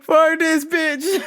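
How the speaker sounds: slow, laughing exasperation; real laugh through it. Real, not acted. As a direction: laugh it out, fed up.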